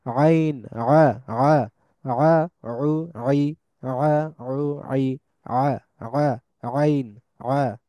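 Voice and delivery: আইন, আ, উ, আই squeezed from the throat; isolated pharyngeal ain drill, each sound held separately; Arabic ain sound. Shown as a demonstration. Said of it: "এটার উচ্চারণটা হচ্ছে এরকম".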